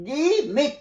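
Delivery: গিমিক with a hard g as গি, high and separated from মিক. Shown as a demonstration. Teacher showing the exception word, গিমিক with a hard g. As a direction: say গিমিক starting with গি, never a জি sound. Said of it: "জি বলছি না, গি".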